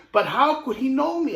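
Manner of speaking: put-on a boy amazed to be known; puzzled little boy. Child voiced in story.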